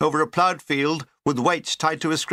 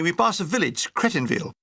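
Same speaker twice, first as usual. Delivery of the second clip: ordinary delivery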